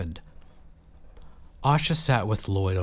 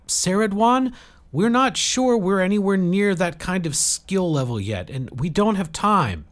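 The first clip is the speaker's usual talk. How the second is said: urgent protest; 11 semitones higher, 6 dB louder than usual. Acted. Jill objecting urgently.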